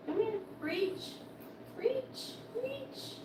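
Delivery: high, soft, coaxing call to the dog; sing-song coaxing, drawing her in; coaxing pet talk. Real, not acted. Owner coaxing her dog to reach for a treat, soft and high.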